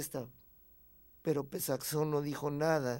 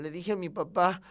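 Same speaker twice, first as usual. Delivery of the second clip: plain reading voice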